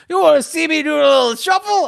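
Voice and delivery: high, loud mock-Cockney song-and-dance man. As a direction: offer a turn in hammed-up Cockney. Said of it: "he puts on the big Cockney accent he had in Mary Poppins".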